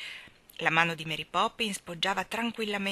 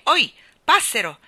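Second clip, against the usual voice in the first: sharp, loud, high call; shout; 5 semitones higher, 13 dB louder than usual. A narrator's reading. Mary Poppins interrupts the bird, shouting. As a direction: cut in with a shout. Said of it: "gridò Mary Poppins".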